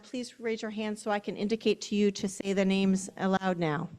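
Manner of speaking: ordinary delivery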